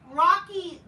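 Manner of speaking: sudden high, loud cry of disbelief; exasperated outburst. Real, not acted. Venting about the Rocky ripoff, high exasperated cry. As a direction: blurt it out in disbelief.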